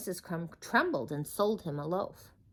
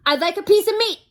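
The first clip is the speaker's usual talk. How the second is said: high, loud, fast boom; 14 semitones higher, 11 dB louder than usual. Acted. Mister Noisy orders meat from the butcher, booming. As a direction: bellow the order. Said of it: "he boomed".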